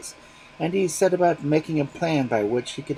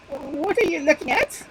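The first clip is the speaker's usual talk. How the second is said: much higher and slower, a frightened bird; trembling voice; 5 dB louder, slower than usual. Acted. Magpie fearfully asking the fox under her tree, trembling. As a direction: ask it dreading the answer. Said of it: "asked the trembling voice".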